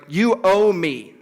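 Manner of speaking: slow, held-back claim that God owes; inner resentment. Retold or imitated. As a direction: resentment kept inside, unspoken. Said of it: "the posture of my heart".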